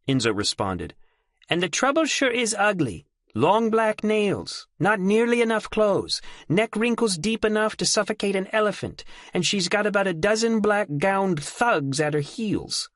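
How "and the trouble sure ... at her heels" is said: put-on lifted, youthful boy's voice with a sneer; young Enzo's voice. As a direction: play the cocky kid scouting the enemy. Enzo reports from the courtyard.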